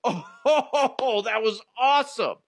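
high, delighted outburst; a kid thrilled by a movie explosion; gleeful cheer in the theater. Retold or imitated. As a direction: cheer like the gore was the best part. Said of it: "and I'm like".

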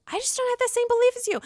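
mock dismissive retort; Vicky retorting. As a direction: imitate her brushing it off quickly. Vicky retorting in retold argument.

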